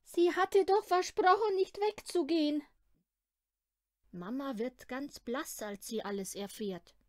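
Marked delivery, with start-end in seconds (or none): 0.0-2.6 high, loud, weeping complaint; tearful nanny. Acted. Nanny weeps that the girl promised not to leave. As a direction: cry it out in distress. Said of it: "weint sie".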